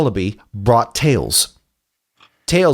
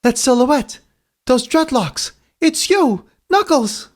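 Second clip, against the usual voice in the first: high-pitched, awed hero voice; young Tails recognizing someone; 11 semitones higher, slower than usual. Acted. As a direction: voice pitched well up, realization dawning. Tails's line in the story.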